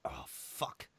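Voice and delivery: whispered curse under breath; hushed frustrated aside. Real, not acted. Muttered aside while remembering.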